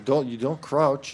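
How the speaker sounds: normal talking voice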